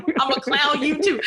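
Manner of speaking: high, playful pretend threat; mock comeback. Promising to clown them back, high mock defiance.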